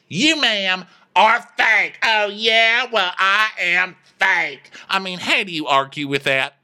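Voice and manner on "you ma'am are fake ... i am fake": high, louder hammed-up accusations; mock quarrel. Two women squabbling about fakeness, hammed-up quarrel. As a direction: trade retorts with raised mock outrage. Acted childish quarrel escalating.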